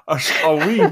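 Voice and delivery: a hammed-up Glasgow accent broken by giggles; laughing Glasgow attempt. Friend dares him to try, a laughing mock Glasgow accent. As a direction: try a wee Glasgow voice and crack up. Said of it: "See, give it a go".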